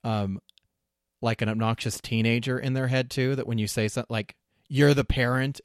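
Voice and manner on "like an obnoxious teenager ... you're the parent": normal talking voice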